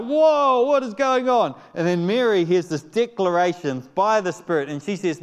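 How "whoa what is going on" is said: high, loud amazed exclaim; a loud, high-pitched gasp of amazement; astonished whoa. Retold or imitated. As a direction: burst out in wonder as Elizabeth. Elizabeth voiced in retold story.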